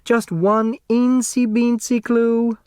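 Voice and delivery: high, slow coaxing plea; wheedling. A narrator's reading. Grace wheedling her daughter for a hint, high and slow. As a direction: coax the clue out of her sweetly. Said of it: "Grace wheedled".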